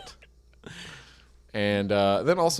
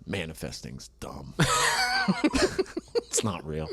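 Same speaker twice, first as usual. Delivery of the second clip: paranoid prepper; 5 semitones higher, 5 dB quieter than usual. Pretend. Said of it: "This is my impression of a doomsday prepper on his deathbed".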